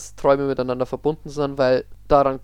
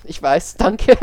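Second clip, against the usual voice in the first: laughing; 5 semitones higher, 10 dB louder, much slower than usual. Real, not acted. Jokingly accepts praise.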